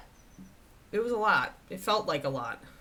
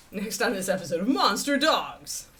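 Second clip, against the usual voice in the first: louder, trailer-announcer read; 8 dB louder than usual. Shown as a demonstration. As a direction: announce like a TV show intro. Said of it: "Let's use the voice".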